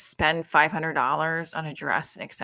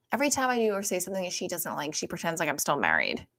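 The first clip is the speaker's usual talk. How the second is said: usual speaking voice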